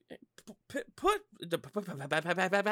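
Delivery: stuck stutter on the p; real stuttering. Real, not acted. Trying to say "put" mid-rant, real stuttering. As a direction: trip over the p in rapid repeats. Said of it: "I know I'm fucking stuttering".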